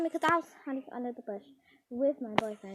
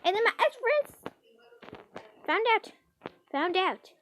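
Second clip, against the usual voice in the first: usual speaking voice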